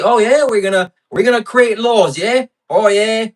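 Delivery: high, loud caricature of a British empire-builder; mock British voice. Streamer mimicking tea-drinking British lawmakers, mocking British accent. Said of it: "they were just drinking tea".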